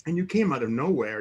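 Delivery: ordinary talk, nothing marked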